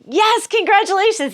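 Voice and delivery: high bright mock congratulations; prize recording voice. Prank line repeating itself to Greg, mock cheery. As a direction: chirp it like a canned promo. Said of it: "He's like".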